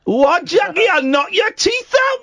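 mock Geordie threat, high and slow; a hammed-up Newcastle threat, yelling Mark high; bad Geordie accent. Host jokingly threatening a caller, hammed-up Geordie accent. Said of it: "Ah, terrible, mate".

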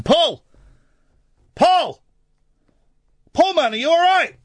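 loud, high shout down a tunnel; worried yell, re-enacted at full pitch; calling out. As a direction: call to a friend out of sight. Re-enacts calling to friend in cave.

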